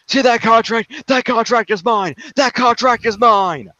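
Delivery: high, insistent wrestling promo rant; the Miz. Retold or imitated. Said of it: "The Miz takes it over to the commentary table".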